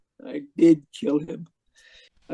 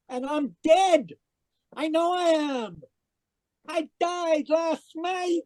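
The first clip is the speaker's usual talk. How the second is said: hysterical insistence; 8 semitones higher than usual. Acted. Man insists he is dead.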